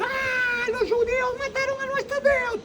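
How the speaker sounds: high, shrill-free mock crowd yell, far above his voice; mob shouting. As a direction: shout it like an angry mob accusing, half laughing. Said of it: "y eran gritos". (grito exagerado de una multitud acusando)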